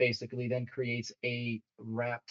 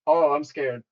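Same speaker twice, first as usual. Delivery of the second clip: mock scared; 9 dB louder than usual. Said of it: "You're scared of this".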